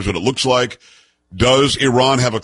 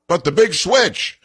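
higher, drawn-out mimicry of a protest; mocking objection; 7 semitones higher, slower than usual. Host parroting critics' big switch argument, mockingly. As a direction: parrot the opponents' comeback in a raised, slow voice.

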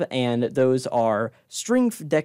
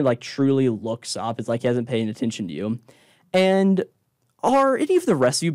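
ordinary delivery

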